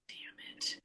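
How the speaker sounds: sighing, whispered damn it; soft sighing whisper at yourself; whispered curse. Real, not acted. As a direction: mutter it under your breath, frustrated. Forgets the name, frustrated.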